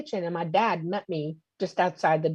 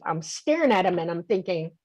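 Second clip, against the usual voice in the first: usual speaking voice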